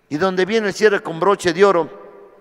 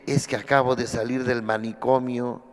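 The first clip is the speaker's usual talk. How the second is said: much lower and softer, confiding; 8 semitones lower, 7 dB quieter than usual. Retold or imitated. The newcomer quietly confessing to the coordinator that he just left the asylum. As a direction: lean in and say it low, like a secret. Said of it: "pero en voz baja".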